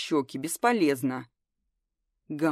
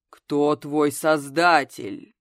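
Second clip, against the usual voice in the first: low rumbling voice; 3 semitones lower, 7 dB louder, much slower than usual. A narrator's reading. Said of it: "изменил голос до низкого".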